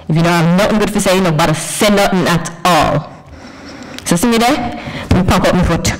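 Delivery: lightly voiced Jamaican patois, cheeky and quick; sassy Jamaican patois. Speaker voicing her inner monologue at work, sassy Jamaican patois. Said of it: "That was her speaking".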